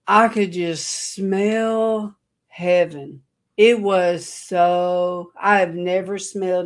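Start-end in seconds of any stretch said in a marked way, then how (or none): 0.1-5.3 very slow, drawn-out rapture; slow awed wonder. Retold or imitated. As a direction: let each word hang in awe. Overwhelmed by a holy aroma.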